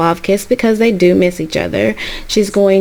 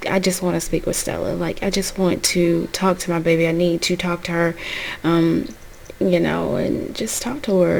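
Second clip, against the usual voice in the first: much softer, pleading, hurried at first; soft plea; 3 semitones lower, 7 dB quieter than usual. Retold or imitated. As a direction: plead gently at the door. Mother pleads to see daughter.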